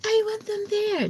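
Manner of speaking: put-on high whiny childlike plea, way up in pitch; Stuart from Mad TV. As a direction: imitate mom as a pleading Mad TV Stuart. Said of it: "if y'all know Stuart from Mad T V".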